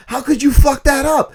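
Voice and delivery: hammed-up Philadelphia gangster berating his brother; William Hurt's Philadelphia accent. Quoting the brother scolding Joey, loud high Philadelphia accent. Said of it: "he's doing this Philadelphia accent".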